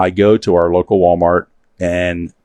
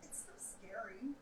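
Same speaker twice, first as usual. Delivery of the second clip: whispered nerves; 35 dB quieter than usual. Real, not acted. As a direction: say it under your breath. Nervous before race.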